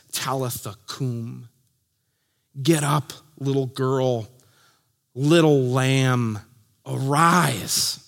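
low, soft and slow call to wake; tender command. Acted. Jesus softly telling the dead girl to rise, low and gentle. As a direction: gently rouse a sleeping child.

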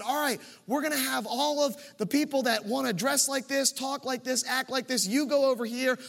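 rally the room, pressing each question home; preaching at full force. Acted. Pastor stirring his congregation toward unity, preaching at full force.

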